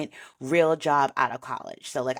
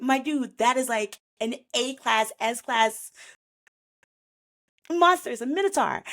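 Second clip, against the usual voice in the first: much higher, stunned and excited; amazed disbelief; 7 semitones higher than usual. Retold or imitated. Guild crowd telling the hero the monster was huge, high excited. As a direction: gush at the hero in shock. Others react to his feat.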